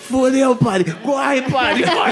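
put-on high, frantic drunk's yell through laughter; panicked drunk. Drunk yelling to the priest to run, panicked high voice. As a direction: shout it like a drunk fleeing in panic.

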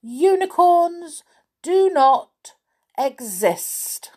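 high, loud, slow denial; stubborn insistence. Acted. Miss Stubborn denying the unicorn before her, insistent. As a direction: punch out each word slowly.

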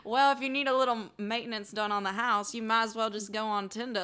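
put-on genteel Southern belle accent; playful Southern lady dispensing advice; Blanche. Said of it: "sound like Blanche from the Golden Girls".

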